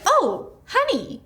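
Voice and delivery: high, slow, syrupy mock sweetness; condescending mother-in-law. Mother-in-law denies her cake, mock condescending sweetness. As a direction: swoop up high and draw out each word. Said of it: "That's how she talks".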